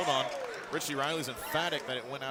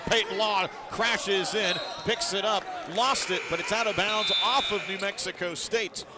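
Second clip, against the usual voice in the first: raised, louder play-by-play burst; excited call; 4 semitones higher, 4 dB louder than usual. Real, not acted. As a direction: call a frantic scramble as it happens. Live call of scramble for ball.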